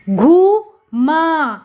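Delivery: घूमा very slowly, as घू … मा; syllable by syllable. Shown as a demonstration. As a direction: stretch and separate both syllables of घूमा. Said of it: "जोड़कर पढ़ेंगे".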